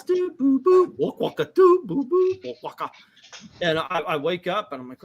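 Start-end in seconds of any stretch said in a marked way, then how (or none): 0.0-2.9 high-pitched mimicked call jingle; sung-out ringtone noises; Skype ringtone imitation. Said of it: "You know that thing it does".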